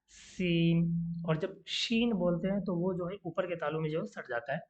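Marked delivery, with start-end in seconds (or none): 0.1-1.2 सीन drawn out very slowly, hissing s at the teeth; letter seen. Shown as a demonstration. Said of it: "दांत के gate पे ही आप बोल सकते हो".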